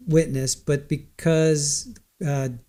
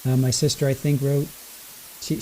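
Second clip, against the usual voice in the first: usual speaking voice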